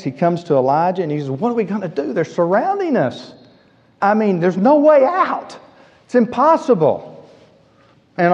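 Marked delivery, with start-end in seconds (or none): 1.3-7.3 acted-out panic, pitch climbing high; panicked. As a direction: play a servant who sees no escape. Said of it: "He panics".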